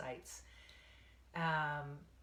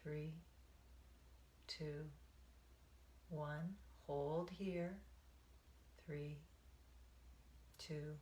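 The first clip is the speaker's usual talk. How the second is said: guided meditation. Acted. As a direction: ease them into the breath, one count at a time.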